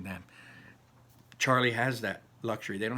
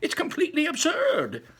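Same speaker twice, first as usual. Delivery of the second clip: put-on high, lively old man voice; a grandpa bursting with wonder; old grandfather; 6 dB louder than usual. Grandpa Joe dazzling Charlie with Wonka's inventions, high and lively.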